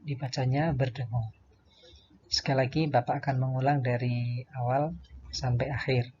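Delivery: usual speaking voice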